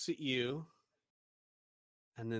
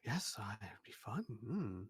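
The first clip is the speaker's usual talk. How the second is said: softer, lightly voiced pondering craftsman; musing shopkeeper; 7 dB quieter than usual. Shopkeeper weighing the job in a soft musing voice. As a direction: think it over half to yourself. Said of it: "he looks at it and he says".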